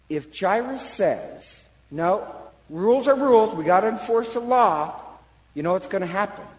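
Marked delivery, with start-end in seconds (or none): 1.9-5.0 loud, higher insistence on the law; strict insistence. Acted. Jairus insisting to the crowd, loud strict voice. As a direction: hammer home the rules.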